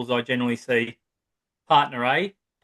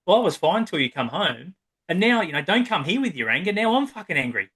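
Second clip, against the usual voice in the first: higher, rising irritation, a touch of a snap; mock annoyed partner; 6 semitones higher than usual. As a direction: play the fed-up partner pushing back. Partner snapping back in quarrel.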